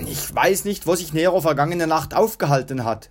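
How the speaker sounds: ordinary delivery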